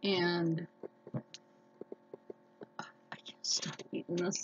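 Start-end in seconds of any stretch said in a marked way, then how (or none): 2.8-4.4 soft unvoiced whisper while chewing. Real, not acted. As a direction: whisper it between bites.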